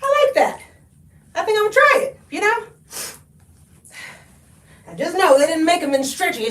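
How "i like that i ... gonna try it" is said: higher, laughing shopper voice; a delighted stranger spotting the find; imagined shopper. Pretend. Viewer shopping spots the onesie, higher laughing voice. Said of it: "you can be like".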